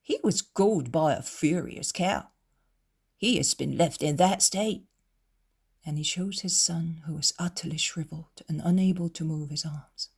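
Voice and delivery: usual speaking voice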